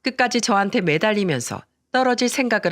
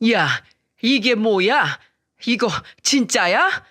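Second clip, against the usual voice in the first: slow, drawn-out indignant mother-in-law; mild anger; 3 semitones higher, slower than usual. A narrator's reading. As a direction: confront her slowly, with contained outrage. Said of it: "화를 내며 말했습니다". (천천히 따져 묻는 화난 말투)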